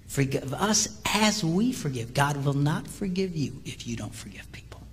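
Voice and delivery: ordinary talk, nothing marked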